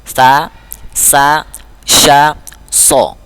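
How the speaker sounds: "Sa, sa, sha, so": lisped, hissed, hushed, then rounded; contrasting sibilant letters. Shown as a demonstration. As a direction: keep "Sa, sa, sha, so" apart, one sibilant each. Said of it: "Wajib beda".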